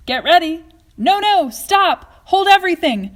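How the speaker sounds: high, loud stop-everything shout; urgent shout. Acted. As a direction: halt them all at once. Wonka halting the broadcast.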